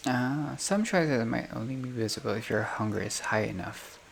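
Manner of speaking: usual speaking voice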